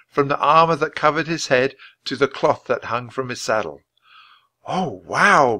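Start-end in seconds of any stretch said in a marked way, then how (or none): 4.7-5.6 awed, higher and louder exclamation; impressed little girl's wow; breathed in wonder. A narrator's reading. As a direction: let out an amazed wow. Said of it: "breathed Annie".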